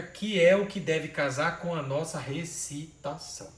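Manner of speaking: usual speaking voice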